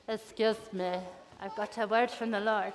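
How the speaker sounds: low, soft, hesitant address; meek little public announcement; timid announcement. Acted. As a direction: politely ask for attention, softly. Acting timid Jonah addressing city.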